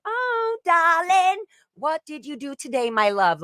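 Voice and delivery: very high, put-on darling voice; mock aggressive endearment. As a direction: needle them with high-pitched sweet talk. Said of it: "aggressive and".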